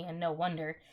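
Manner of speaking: ordinary delivery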